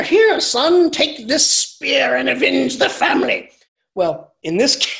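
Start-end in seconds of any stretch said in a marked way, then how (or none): 0.0-3.5 high, loud, grandly solemn father handing over the spear; hammed-up heroic father passing on the family spear; solemn epic father. Father in an epic hands his son the ancestral spear, hammed-up solemn.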